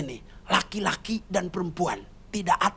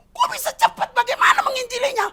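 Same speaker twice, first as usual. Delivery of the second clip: high, loud astonished asking; can't believe how fast it happened; surprised question; 3 dB louder than usual. Retold or imitated. Surprised listener in Jakarta.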